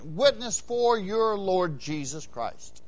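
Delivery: normal talking voice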